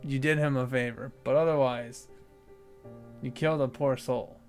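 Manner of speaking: usual speaking voice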